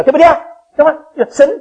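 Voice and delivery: normal talking voice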